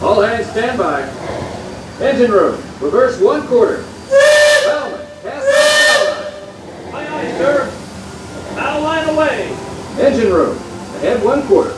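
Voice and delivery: a captain calling orders to the crew; steady ship captain giving commands; riverboat captain. Acted. Ride skipper spiel voice.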